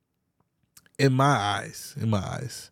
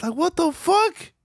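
squeaky, much higher what the fuck; outraged disbelief. Real, not acted. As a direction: shoot the voice way up in shock. Outraged reaction to quoted demand.

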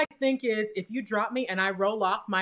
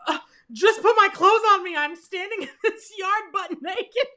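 frantic inner panic; 9 semitones higher, 4 dB louder than usual. Retold or imitated. Frantic thought while injured in yard.

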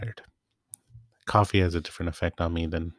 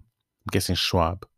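ordinary talk

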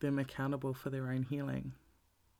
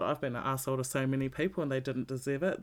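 ordinary talk, nothing marked

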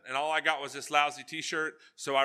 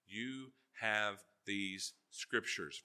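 softer, slow, lower emphasis on have; soft earnest; 5 semitones lower, 7 dB quieter, much slower than usual. Shown as a demonstration. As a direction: lean in and say it gently. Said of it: "repeated key line".

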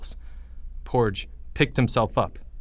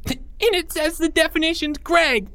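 much higher, gleeful squeal; a kid squealing a mean joke. Acted. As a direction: pipe up high across the room. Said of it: "squealed Porge".

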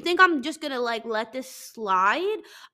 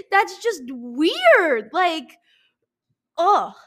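higher, louder, slow disgust; 4 semitones higher, 8 dB louder, much slower than usual. Real, not acted. Ranting about reality show couples, slow and loud disgust. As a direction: drag out the disgust.

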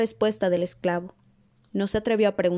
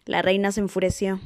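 ordinary delivery, nothing marked

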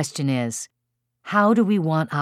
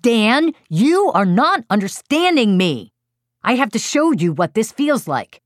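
loud, high, fed-up parent yelling; exasperated yell; 7 semitones higher, 5 dB louder than usual. Retold or imitated. Exasperated parent yells at four-year-old who keeps poking his brother, loud. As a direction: yell it at your child, at wit's end. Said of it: "I yelled".

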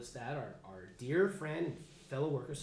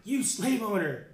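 mock accusation; 6 semitones higher, 8 dB louder, slower than usual. Mock harsh opening of letter.

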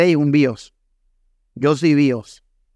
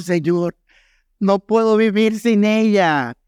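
slow, higher-pitched imitation of crying; mock tearful plea; 4 semitones higher, slower than usual. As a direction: plead to God in a lightly acted wail. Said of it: "me acuerdo que llorando". (súplica llorosa imitada)

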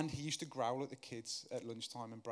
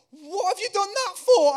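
much higher, louder mock teacher squeal; laughing teacher; 22 dB louder than usual. Dinner lady laughing at the stuck boy, squealing mock teacher. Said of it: "they're going".

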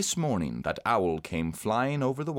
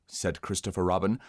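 plain reading voice

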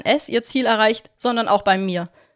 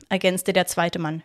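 plain reading voice

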